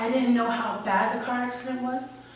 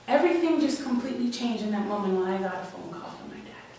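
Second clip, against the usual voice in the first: ordinary delivery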